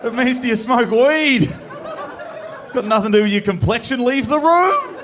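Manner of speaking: his own brain berating him, high and exasperated; scolding inner voice. Retold or imitated. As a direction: yell at yourself in disbelief. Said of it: "my brain's gone".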